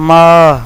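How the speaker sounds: teacher calls out ماں as a drill; loud long maa. Shown as a demonstration. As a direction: call out ماں clearly. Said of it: "بہت سے لوگ اس کو ماں پڑھتے ہیں".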